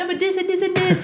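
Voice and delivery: high, insistent mimicked explanation; imitating his explaining. Imitating partner explaining a word, high insistent voice. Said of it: "So he's like".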